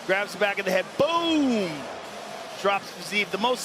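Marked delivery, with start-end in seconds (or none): 1.0-1.7 excited boom. Real, not acted. Replay call of knockdown.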